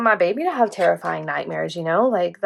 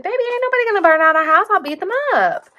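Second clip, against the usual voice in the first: mock tough mama; 12 semitones higher, faster than usual. Mom comforting scared son, retold.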